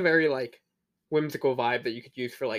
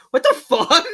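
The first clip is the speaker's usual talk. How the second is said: higher and louder, laughing disbelief; shocked; 10 semitones higher, 11 dB louder, slower than usual. Retold or imitated. Recalling first watching Resurrection F, laughing shock. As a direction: burst out in amused shock. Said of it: "just being like".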